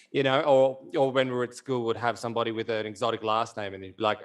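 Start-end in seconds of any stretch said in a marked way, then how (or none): none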